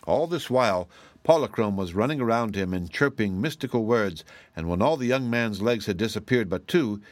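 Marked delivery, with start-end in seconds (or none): none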